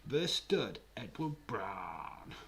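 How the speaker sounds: slow hammed-up presenter voice; grand mock introduction; mock announcer. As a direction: announce him like a champion.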